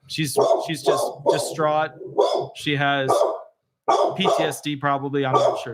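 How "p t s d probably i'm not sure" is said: usual speaking voice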